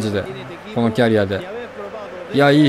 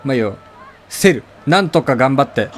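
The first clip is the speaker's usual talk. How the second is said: ordinary talk, nothing marked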